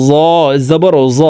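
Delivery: জ said thick and full; heavy letter. Shown as a demonstration. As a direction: make জ heavy, unlike thin জাল. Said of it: "জ হরফকে মোটা করে উচ্চারণ করতে হবে".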